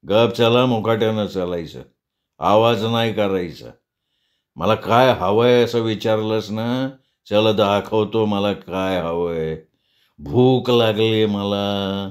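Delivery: low, slow, slurring threat; menacing drunkard. Acted. Madhu ordering her to walk silently, low drunken menace. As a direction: drag each phrase out, lecherous and commanding.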